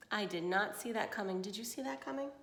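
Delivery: plain reading voice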